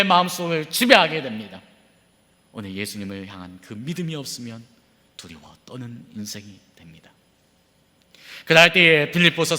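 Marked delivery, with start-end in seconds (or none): 2.5-7.1 much softer, low, grave murmur; hushed warning. Acted. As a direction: let it sink to a near whisper. (낮고 조용한 경고)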